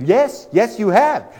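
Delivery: high, loud eager yes; emphatic agreement. Retold or imitated. Guest eagerly confirming to the clerk, high and loud. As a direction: jump on the clerk's admission. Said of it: "And I said".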